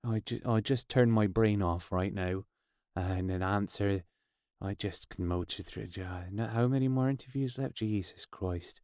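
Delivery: very low, soft mock-weary mumble; weary inner monologue. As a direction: switch your brain off and murmur through it. Musicians' weary thoughts during interviews.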